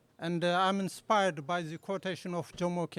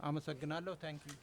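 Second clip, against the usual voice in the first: ordinary talk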